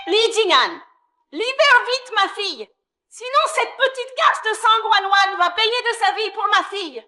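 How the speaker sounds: high, frantic ultimatum; menacing demand. Acted. Hostage taker demanding her daughter's freedom, menacing. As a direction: threaten in a high, desperate voice.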